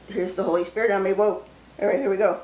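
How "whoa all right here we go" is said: ordinary talk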